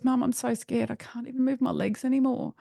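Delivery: put-on high-pitched, pleading child's voice; frightened child. Collapsed daughter pleading to her mum, high and frightened. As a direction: imitate a scared child calling for help.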